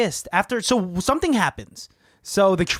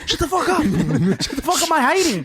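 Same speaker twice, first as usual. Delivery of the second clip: mock panicked whisper; 6 semitones higher than usual. Acting hiding Harry.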